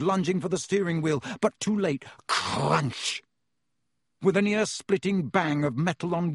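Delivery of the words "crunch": drawn-out crashing word; sound-effect word. A narrator's reading. Narrator imitates the crash.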